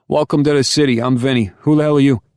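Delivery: high, loud, booming New York Italian greeting; big New York Italian welcome, all boom; boisterous New York Italian. Acted. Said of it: "the big, boisterous tone most New Yorkers carry".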